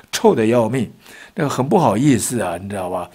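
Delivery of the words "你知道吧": ordinary delivery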